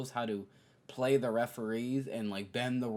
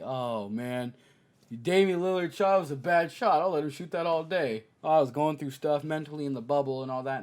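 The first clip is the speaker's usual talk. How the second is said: mock excuses; 3 semitones higher, 6 dB louder than usual. Mocking Paul George excuses.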